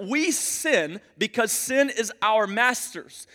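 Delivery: ordinary talk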